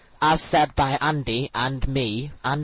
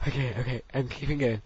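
ordinary talk, nothing marked

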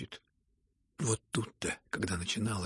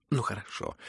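plain reading voice

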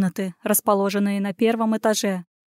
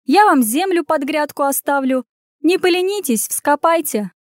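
mocking; 5 semitones higher than usual. Mocking the prince.

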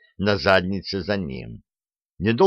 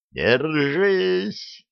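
shouting; 8 semitones higher than usual. A narrator's reading. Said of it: "только успел проорать я".

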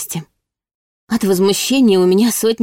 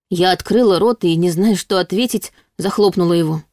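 usual speaking voice

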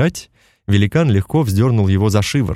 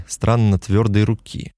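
ordinary delivery

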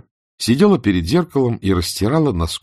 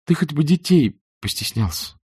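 sad; 7 semitones higher than usual. A narrator's reading. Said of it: "сказала она грустно".

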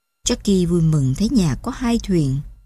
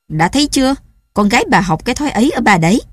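louder, fast, jabbing accusation; angry blame; 4 semitones higher, 4 dB louder, much faster than usual. Acted. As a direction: turn the anger on the wife. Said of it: "cơn giận của Chemban". (trách móc giận dữ, to và nhanh)

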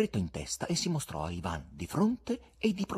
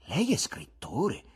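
raised pitch, drawn-out question; keen interest; 5 semitones higher, slower than usual. A narrator's reading. Poet questioning the guest about his writing, keenly interested. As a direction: ask slowly, with lifted interest. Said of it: "chiese con interesse il poeta".

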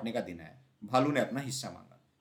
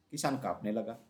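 usual speaking voice

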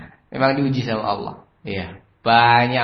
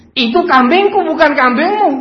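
high-pitched shouted protest; acted furious shout; angry boss; 11 dB louder than usual. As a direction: yell it as the outraged owner. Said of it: "Marah-marah dia".

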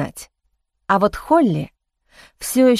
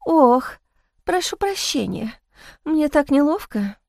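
weak recovering lady; 5 semitones higher than usual. Acted. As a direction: come round sounding frail. Feigned recovery after fake faint.

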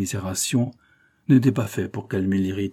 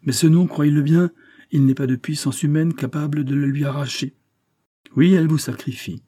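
raised, emphatic pleading; impassioned insistence; 5 semitones higher, 4 dB louder than usual. A narrator's reading. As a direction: press the truth on him forcefully. Verduret insists passionately on the truth. (insistance véhémente)

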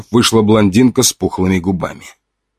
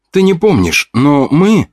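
put-on higher pleading girl voice; young woman voice; 7 semitones higher, slower than usual.

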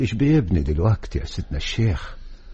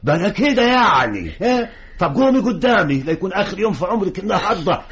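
high, loud, rising angry threats; furious father; 12 semitones higher, 4 dB louder than usual. Acted. Father threatens his daughter who refuses to get up, shouting high and loud. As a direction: bark at the girl to get up.

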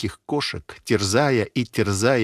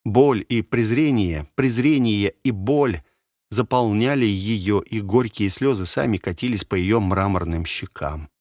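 ordinary delivery

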